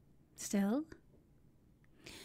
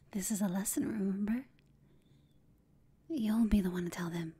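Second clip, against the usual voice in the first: ordinary delivery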